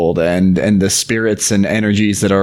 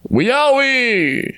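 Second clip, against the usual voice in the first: very high, long-held call; 14 semitones higher than usual. A narrator's reading. Spirit calling down from the sky, long high call. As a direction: stretch the name out high from the sky.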